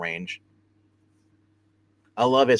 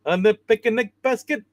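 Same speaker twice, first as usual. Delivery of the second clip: much higher cartoon bear voice, louder; Yogi Bear voice; 11 semitones higher, 6 dB louder than usual. Shown as a demonstration. Host redoes the bear line after a friend's correction, higher cartoon voice. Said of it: "put that third syllable in picnic".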